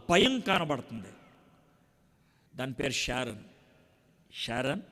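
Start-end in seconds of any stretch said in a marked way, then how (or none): 4.3-4.8 soft, gentle call of a name; gently calling a name. Retold or imitated. Father softly calling his crying daughter, gentle. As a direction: softly call the little girl. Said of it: "అని అన్నాను".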